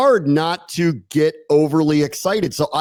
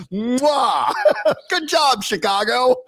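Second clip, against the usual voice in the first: laughing; 9 semitones higher, slower than usual. Real, not acted. Laughing at the Bears.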